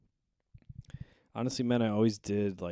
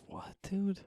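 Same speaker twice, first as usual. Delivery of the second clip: high shocked question; 13 semitones higher than usual. Retold or imitated. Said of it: "I said".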